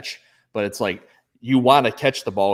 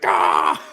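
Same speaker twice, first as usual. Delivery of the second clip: pained yelp; 4 semitones lower, 6 dB louder than usual. Retold or imitated. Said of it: "and he's like".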